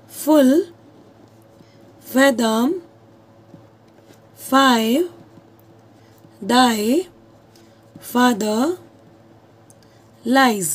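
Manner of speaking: each word set apart with long pauses; slow, word by word. Shown as a demonstration. Teacher reading an example to her class, word by word. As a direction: read it out slowly, pausing after every word. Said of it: "आपके सामने लिखती हूँ example".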